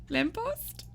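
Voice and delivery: squeaky call. Pretend. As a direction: squeak Lamppost? way up high. Calling a viewer's name.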